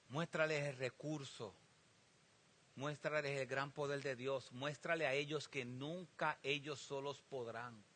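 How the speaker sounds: hushed, slow pleading prayer; quiet prayerful plea; soft prayer. Acted. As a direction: pray it low and tender. Paul prays for the people.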